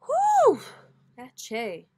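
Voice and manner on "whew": sudden high loud whoop of an exhale; loud whew. Real, not acted. Reacting to the neighbour's car, a loud high whew. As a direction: let out a big startled whew.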